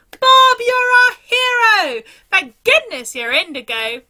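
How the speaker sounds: high, loud cheer fading to grateful. Acted. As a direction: hail him as a hero. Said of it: "they shouted".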